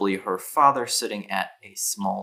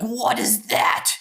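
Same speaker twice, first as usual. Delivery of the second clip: put-on high, drawn-out kid's voice, amazed; young boy; slower than usual. Young boy spotting a bug in someone's hair, amazed. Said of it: "the younger boy. He's like".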